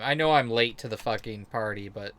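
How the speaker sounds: normal talking voice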